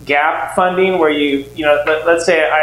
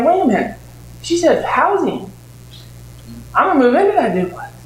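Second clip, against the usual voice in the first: sudden realization; 8 semitones higher than usual. Retold or imitated. Sudden idea while driving.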